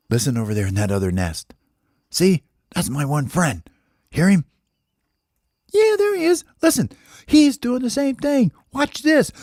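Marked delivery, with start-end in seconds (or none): 2.1-9.3 high squeaky little bird voice; excited baby bird. Acted. Baby bird pointing out his friend to daddy, excited. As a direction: bubble over with excitement.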